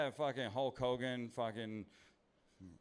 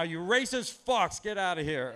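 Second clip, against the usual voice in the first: loud, higher mock heckler shouting; imagined heckler yell; 7 semitones higher, 9 dB louder than usual. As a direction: bark it like an offended crowd. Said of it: "y'all just said in your head".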